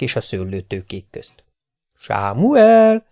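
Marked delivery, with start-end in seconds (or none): 1.9-3.1 magas, elnyújtott hívogatás; hívogasd, mint egy elkóborolt macskát; szólítgatva. A narrator's reading. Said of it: "Szólítgatták a kis páncélos vitézt". (éneklő hangú szólongatás)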